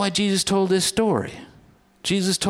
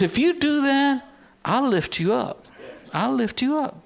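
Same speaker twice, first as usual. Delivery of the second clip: uplifting promise; 7 semitones higher than usual. Acted. Voicing God's promise, pitch up.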